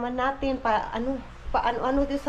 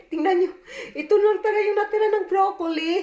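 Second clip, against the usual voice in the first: laughing through the words, pitched up; 7 semitones higher, 3 dB louder, faster than usual. Real, not acted. Mom showing viewers scraps of broccoli, laughing. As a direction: giggle while showing the last bit of broccoli.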